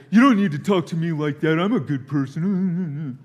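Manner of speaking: high, loud indignant protest, slowing at the end; hammed-up wounded pride; mock defensive man. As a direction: huff it out like the offended one. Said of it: "I can say".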